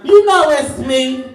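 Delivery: drawn-out, teasing, loud; sing-song tease. Acted. Comic to crowd, slow loud teasing. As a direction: stretch it out like a taunt.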